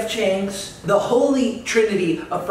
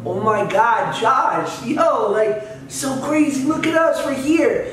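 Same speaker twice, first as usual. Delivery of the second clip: acted gushing reunion, climbing higher; imagined excited greeting; 5 semitones higher, slower than usual. Actor imagines old co-star greeting him, acted excitement. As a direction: build to a high, starstruck hello. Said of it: "I thought he was gonna go".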